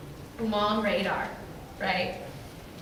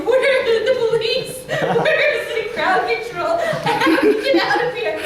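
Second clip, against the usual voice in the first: much higher, louder panicked pleading with a laugh; mock-frantic cry for rescue; retold panic; 12 semitones higher, 9 dB louder than usual. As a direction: call for help half-laughing at herself. Panicking in pushing crowd.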